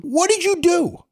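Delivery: high, slow accusing question; dad interrogating. Retold or imitated. Father confronting his son over the broken handle, high and slow. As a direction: lean in and demand an answer slowly.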